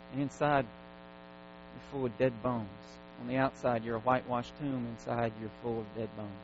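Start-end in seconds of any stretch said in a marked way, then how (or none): none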